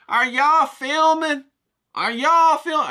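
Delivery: high, loud, slow mock yell; mock yelled question. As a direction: call it out high and drawn-out, playfully. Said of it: "I say".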